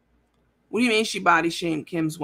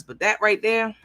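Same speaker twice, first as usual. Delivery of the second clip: ordinary delivery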